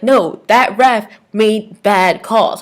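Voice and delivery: slow, put-on voice quoting people; quoting others. Vlogger quoting fans about the ref, slow put-on voice. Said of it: "people have actually said".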